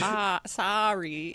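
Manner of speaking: "sorry" opened up to SAH-ree; American "sorry" with ah. Shown as a demonstration. Host showing the required American vowel, "sorry" as SAH-ree. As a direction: lean on the ah: "Ah, sorry". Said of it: "is how you have to say it".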